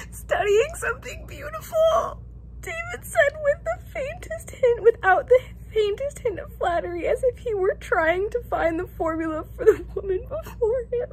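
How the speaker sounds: high, tearful reading voice; happy tears while reading aloud; reading through tears. Real, not acted. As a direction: read it while choking up with joy. Reads passage while emotionally overcome.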